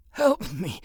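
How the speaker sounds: high, strained wounded man; weak plea. Acted. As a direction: plead like you're barely holding on. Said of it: "the man said weakly".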